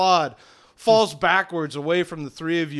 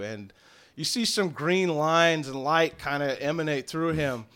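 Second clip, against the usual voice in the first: usual speaking voice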